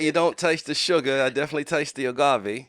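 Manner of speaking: loud, high mock British accent; posh British tea-taster voice, hammed up. Guest hams a British accent over tea. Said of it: "I'm talking in the British accent".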